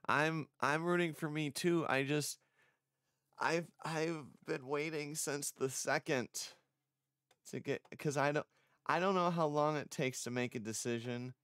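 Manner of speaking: high, loud and eager; rushing out hopeful words; excited. Real, not acted. Excited about the deadline.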